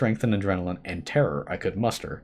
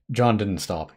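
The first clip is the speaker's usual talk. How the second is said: ordinary delivery, nothing marked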